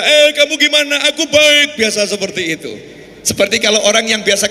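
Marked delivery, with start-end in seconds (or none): 0.0-1.8 raised, higher call of a villager used to calling far; mock villager shouting. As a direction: call out like across distant fields. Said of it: "Jadi biasa kalau ngomong teriak-teriak".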